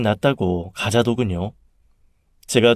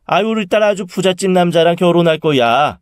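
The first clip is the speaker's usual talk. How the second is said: higher-pitched, animated bragging; boastful mother; 6 semitones higher, 3 dB louder than usual. A narrator's reading. Mother boasts to her sister, proudly. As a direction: show off your daughter's match.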